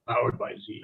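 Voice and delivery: a light stab at a booming truck-rally promo; monster truck ad. Retold or imitated. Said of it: "I always have the monster truck voice".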